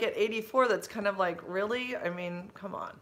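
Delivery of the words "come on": ordinary talk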